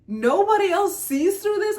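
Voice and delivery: frustrated shout at fake kindness videos; exasperated outburst. Real, not acted. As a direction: burst out in frustration.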